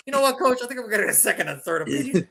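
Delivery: whiny excuse to the coach; mock player to coach. Injured star telling his coach he'll sit out. Mock Kupp quote again.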